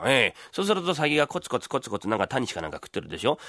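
ordinary talk

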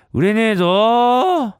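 drawn-out, high, loud grumble; whining shout to nobody in particular; complaining call. Acted. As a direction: holler your complaint out slowly. Vendor complaining aloud in street.

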